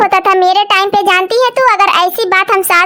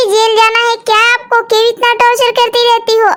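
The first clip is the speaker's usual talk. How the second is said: ordinary talk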